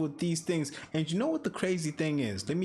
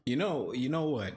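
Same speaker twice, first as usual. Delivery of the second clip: normal talking voice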